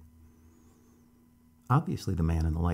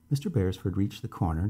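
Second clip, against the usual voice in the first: ordinary delivery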